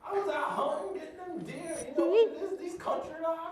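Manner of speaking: very high-pitched put-on country voice; excitable country hunter. Telling the hosts about hunting, in a high-pitched hunter voice. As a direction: squeal it like a cartoon country hunter.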